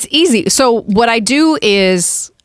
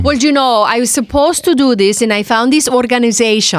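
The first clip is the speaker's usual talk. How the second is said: imitated Spanish accent, higher pitched; Sofia Vergara; 4 semitones higher than usual. Guest mimicking an interviewee with a Spanish accent. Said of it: "like Sofia Vergara".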